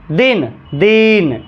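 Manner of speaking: दिन said very slowly with the vowel held; long vowel. Shown as a demonstration. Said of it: "अब ये दीर्घई हो गया".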